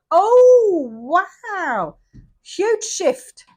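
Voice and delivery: high, loud delighted oh wow; amazed. Real, not acted. Reader to viewers, loud high amazed wow. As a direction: gasp at the big cards. Reacting to major arcana.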